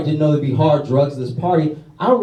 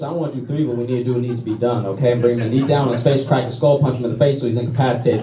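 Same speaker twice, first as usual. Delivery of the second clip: usual speaking voice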